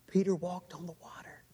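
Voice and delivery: hushed, breathy whisper of wonder; awed whisper. Shown as a demonstration. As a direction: whisper it like a secret marvel. Repeating the walk in awe.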